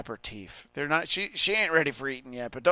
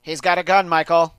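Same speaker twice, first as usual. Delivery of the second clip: loud, raised mock warning; shouted warning; 4 semitones higher, 11 dB louder than usual. Warning Michael about a gun, mock shout. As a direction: call out the danger, much louder and higher.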